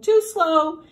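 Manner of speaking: crowing rooster. A narrator's reading. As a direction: crow it out loudly like a rooster. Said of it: "The rooster crowed".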